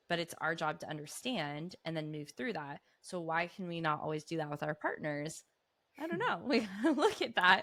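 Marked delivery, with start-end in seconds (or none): 6.0-7.6 laughing. Real, not acted. Laughing at herself.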